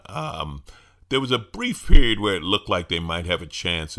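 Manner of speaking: ordinary talk, nothing marked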